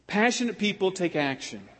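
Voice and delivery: usual speaking voice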